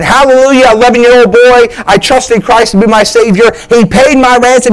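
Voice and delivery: building pulpit fervor; preaching. Acted. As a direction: lift it into the sermon. Rising into preaching.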